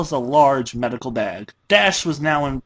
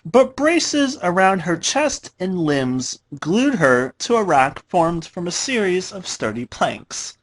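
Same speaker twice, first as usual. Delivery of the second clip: plain reading voice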